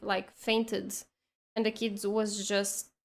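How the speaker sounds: ordinary delivery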